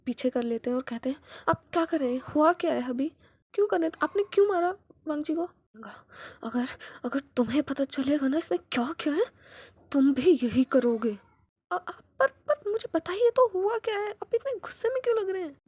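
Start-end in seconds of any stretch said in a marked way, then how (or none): 1.5-5.5 loud, higher, furious questioning; angry demand. Acted. Brother confronting the father over the slap, loud and angry. Demanding why he hit him. 6.4-11.2 angry retort. Acted. Father justifying the slap. 11.7-15.6 much higher, loud, stammering plea; upset pleading. Acted. Jiang Cheng pleading with the angry father, high and flustered.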